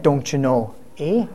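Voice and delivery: low, soft tag in a put-on northern accent; northern-accent aside, dropped low and quiet; mock northern accent. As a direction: lightly imitate a folksy northern accent. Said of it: "you've got that accent".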